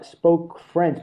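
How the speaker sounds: ordinary delivery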